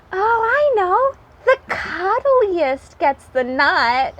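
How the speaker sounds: high, sweet little rabbit. Acted. Little rabbit to the animals, boasting in a small high voice. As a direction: play the tiny rabbit claiming the prize.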